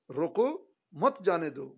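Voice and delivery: रोको set apart, मत जाने दो run together; pause after रोको. Shown as a demonstration. Teacher explains it means stop, pause after रोको. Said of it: "रोकने के लिए बोल रहा है".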